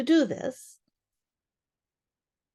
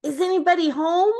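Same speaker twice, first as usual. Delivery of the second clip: higher, louder call into the hole; 9 semitones higher, 4 dB louder than usual. Acted. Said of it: "he called out".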